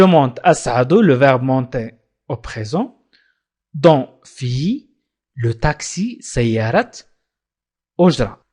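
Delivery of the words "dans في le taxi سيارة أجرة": ordinary delivery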